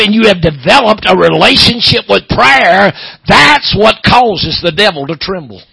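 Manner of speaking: loud, high, hammering revival preaching; fiery Southern pulpit sermon; preaching at full force. Acted. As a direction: preach it hard to the congregation, building.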